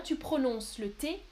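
ordinary talk, nothing marked

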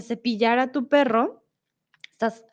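ordinary talk, nothing marked

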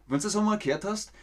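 ordinary talk, nothing marked

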